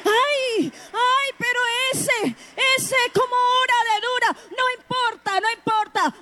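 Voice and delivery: high, shouted exhortation; impassioned, high-pitched pulpit shout; full-force preaching. Real, not acted. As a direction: raise the voice and cry it out to the congregation.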